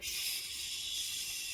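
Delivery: long, soft 'shh'; soothing shush. Retold or imitated. Ian calming a panicking Wanda, long soothing shush. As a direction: hush them with one drawn-out breath.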